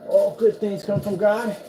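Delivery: slow proclamation. Real, not acted. Solemn proclamation.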